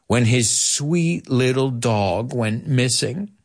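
slow, drawn-out riddle; slow joke setup. Shown as a demonstration. A teacher slowly sets up a cowboy joke for students. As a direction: let each word land. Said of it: "I prepared two for you".

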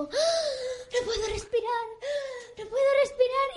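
high, gasping mock agony; hammed-up can't-breathe wail; exaggerated brother. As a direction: fake a dramatic fight for breath. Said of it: "también pueden ser a veces un poco exageradas".

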